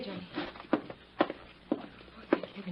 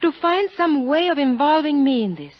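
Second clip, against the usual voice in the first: higher and louder accusing cry; hurt outburst; 8 semitones higher, 7 dB louder than usual. Acted. Maria confronting Johnny about suspecting her, hurt and raised. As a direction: flare up at him, hurt.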